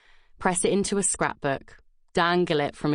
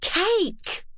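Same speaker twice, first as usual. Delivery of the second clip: excited shout. Acted. Excited friends cheering the cake.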